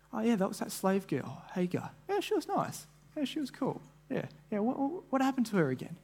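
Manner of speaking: high, soft, offhand servant chatter; breezy voice of someone who's already forgotten her; careless gossip. Retold or imitated. Imagined servants forgetting Hagar.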